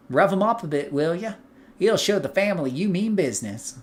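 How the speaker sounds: lightly voiced southern mobster contact; southern accent. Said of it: "You like that southern accent better".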